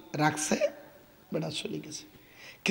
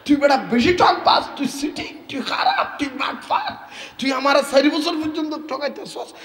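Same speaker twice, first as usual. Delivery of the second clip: higher, rapid stream of insults; abusive scolding; 7 semitones higher than usual. Acted. Shopkeeper cursing the seller in front of people, angry abuse. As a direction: spit insults at the cheat. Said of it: "এমনভাবে গালিগালাজ করতেছে অকথ্য ভাষায়".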